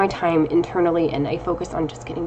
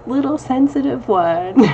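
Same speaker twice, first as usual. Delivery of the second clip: slow, higher teasing voice for the husband; husband teasing his teary wife, slow and higher; 6 semitones higher, 4 dB louder than usual. Retold or imitated. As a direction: tease her gently like the husband did, slowly. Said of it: "and he's just like".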